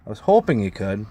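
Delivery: usual speaking voice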